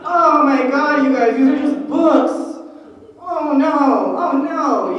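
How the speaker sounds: loud dismay. Retold or imitated. Overwhelmed mid-fight exclamations.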